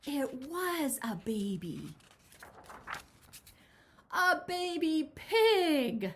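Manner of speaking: high, loud and very slow reveal; storyteller's big reveal; dramatic reveal. A narrator's reading. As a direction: stretch out the surprise for the kids. Reveal of baby pig.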